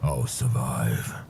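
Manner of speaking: low, slow growl. Acted. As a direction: growl it out through the pain. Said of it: "growling the words out".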